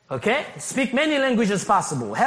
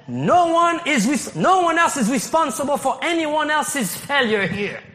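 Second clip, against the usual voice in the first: emphatic preaching; 6 semitones higher than usual. Acted. Pastor rising to emphatic point.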